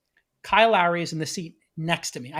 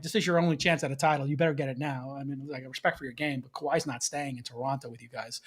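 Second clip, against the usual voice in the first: low, softer trash talk; quiet courtside needling; 4 semitones lower, 5 dB quieter than usual. Retold or imitated. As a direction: keep it low, like talk between seatmates. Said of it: "not talking loud. We're just talking".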